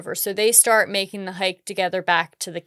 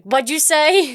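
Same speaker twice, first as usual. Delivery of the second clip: louder, higher challenge; confrontational challenge; 8 semitones higher, 4 dB louder, slower than usual. Retold or imitated. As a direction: call them out loudly. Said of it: "I'm usually like".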